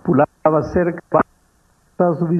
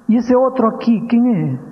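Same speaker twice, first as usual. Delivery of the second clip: put-on child asking; 7 semitones higher than usual.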